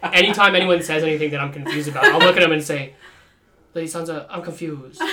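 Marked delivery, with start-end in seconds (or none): 3.7-5.0 put-on softer, laughing silly accent; mock Littlefinger in a goofy accent. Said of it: "With a stupid accent".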